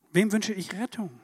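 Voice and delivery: usual speaking voice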